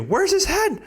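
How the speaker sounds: retold high panicked question; frantic mom. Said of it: "my mom, too".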